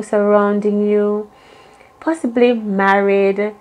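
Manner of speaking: normal talking voice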